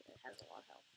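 whispered aside. Real, not acted. Aside under his breath.

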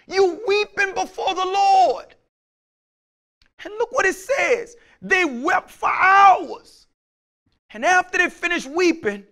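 high, loud and slow preached cry; a shouting preacher stretching every word; preaching at full force. Acted. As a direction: lift the church with it.

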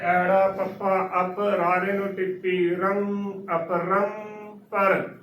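slow letter-by-letter build-up to ਅਪਰੰਪਰ, "ap-rang-par"; slowly spell, then blend ਅਪਰੰਪਰ with long nasal middle; spelled-out correct pronunciation. Shown as a demonstration. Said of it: "ਅਪਰੰਪਰ ਉਚਾਰਨ ਬਿਲਕੁਲ ਸਹੀ ਹੈ".

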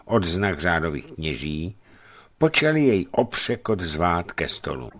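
ordinary delivery

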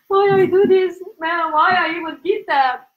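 high, whiny lament; comic wailing regret. Real, not acted. Host regretting her unplanned live to husband, high whiny lament. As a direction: wail it high to your partner.

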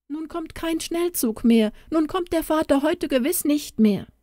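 high plaintive wail of disappointment; lamenting. Acted. Daughter complains to mother that father won't come, high lament. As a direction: whine it out, pitched well up. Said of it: "klagte sie".

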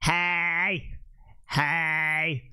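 monotone robot calls; mock robot mimic; robotic voice. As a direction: say each one like a machine, held on one note. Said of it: "Oh, robotic".

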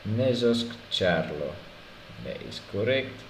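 mock Polish border guard accent. Inspector checking a male visitor's papers, Polish accent. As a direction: read the papers like a stiff inspector. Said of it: "I can do a Polish accent".

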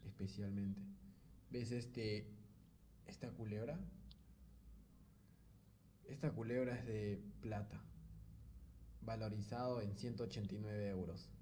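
hammed-up tender declaration to the girl on camera; mock romantic offering. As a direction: hush it like a cheesy proposal.

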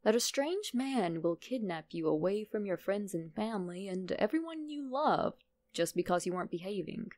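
ordinary delivery

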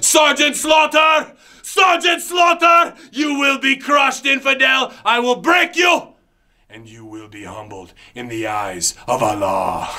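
high shouted threats; villain yelling. Acted. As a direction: bellow the threats at your enemy. Character promo rant.

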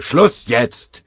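loud, curt order; commanding. A narrator's reading. Sergeant ordering the soldier to stop, loud curt command. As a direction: cut the quarrel off. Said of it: "befahl der Feldwebel".

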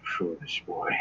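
low, very soft captive murmur; weak, hushed reproach. Acted. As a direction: scold faintly, with little strength. Weakened captive reacts to player.

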